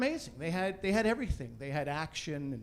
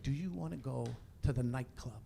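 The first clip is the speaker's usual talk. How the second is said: low, soft bedside voice; wife's hushed coaxing; 6 semitones lower, 9 dB quieter than usual. Acted. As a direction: coax a sleeping partner quietly. Wife waking him at night.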